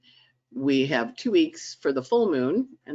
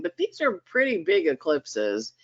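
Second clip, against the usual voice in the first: ordinary delivery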